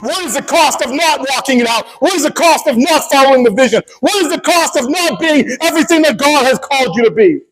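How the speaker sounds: high, insistent rhetorical questions; impassioned preaching. Real, not acted. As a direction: challenge the congregation with ringing repeated questions.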